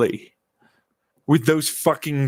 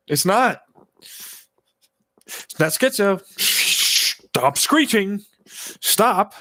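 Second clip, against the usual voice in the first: high, tense back-talk; high agitated pleading; 8 semitones higher, slower than usual. Real, not acted. Arguing with the voices in his head, high and agitated. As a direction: push back hard, voice pitched up.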